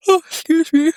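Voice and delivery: speaking through a yawn; yawning apology; mid-yawn. Real, not acted. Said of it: "when I'm yawning".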